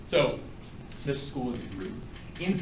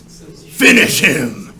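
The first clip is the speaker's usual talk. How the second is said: slow, drawn-out, louder announcer call; Mortal Kombat announcer; 2 semitones higher, 13 dB louder, much slower than usual. Retold or imitated. Teacher quoting Mortal Kombat to class, slow announcer boom. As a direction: boom it like the fighting game announcer. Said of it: "like Mortal Kombat".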